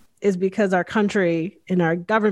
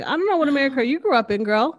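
sassy retort; 6 semitones higher, faster than usual. Retold or imitated. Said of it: "I'm like".

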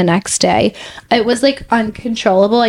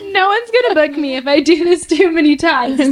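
higher-pitched, laughing through it; laughing while talking; 6 semitones higher than usual. Real, not acted. Model joking about losing bookings, laughing. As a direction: joke about yourself through real laughter. Laughing at her own career risk.

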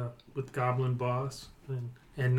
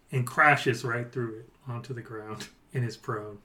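usual speaking voice

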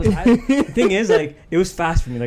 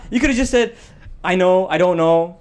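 mock angry; 6 semitones higher, 4 dB louder than usual. Continues mock scolding.

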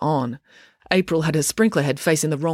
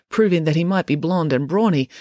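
ordinary delivery

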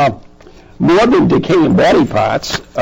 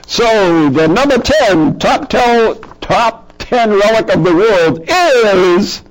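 announcer; 6 semitones higher, slower than usual. Acted. Countdown like a talk show.

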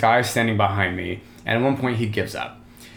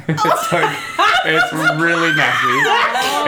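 higher, louder, through laughter; half-laughing, raised voice; laughing embarrassment; 6 semitones higher, 5 dB louder, slower than usual. Real, not acted. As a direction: laugh your way through the admission. Laughing while telling messy kissing story.

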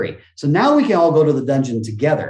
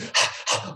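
a quick, breathless gasp of delight; excited gasp; 5 dB quieter than usual. Retold or imitated. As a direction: suck in air like you just got off the ride. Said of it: "The difference is when we're excited".

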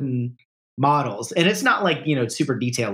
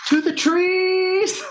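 a high, slow, drawn-out battle call; hammed-up movie rallying cry; much slower than usual. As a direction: call the band to the trees. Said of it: "the other one".

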